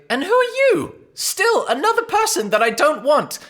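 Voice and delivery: put-on old woman scorning another unwanted visitor; old woman's voice. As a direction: screech it like an annoyed old hag. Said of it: "You see the old woman say".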